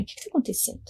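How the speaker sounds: quiet mock of a curt doctor; dry, curt. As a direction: mimic the doctor's cold, curt tone. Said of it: "Bem, bem seca".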